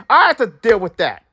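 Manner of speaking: ordinary talk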